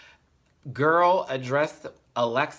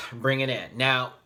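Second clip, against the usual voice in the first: ordinary talk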